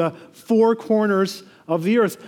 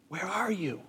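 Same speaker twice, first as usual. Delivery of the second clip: hushed calling; 13 dB quieter than usual. A narrator's reading. God calling out in the garden.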